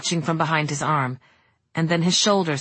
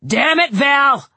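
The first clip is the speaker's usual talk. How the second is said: loud, slow, higher angry shout; 8 semitones higher, 6 dB louder, slower than usual. Acted. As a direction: yell it down the hall. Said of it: "he shouted".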